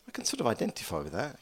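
ordinary talk, nothing marked

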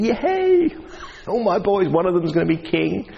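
excited yay. Retold or imitated. Jesse imagined, excited.

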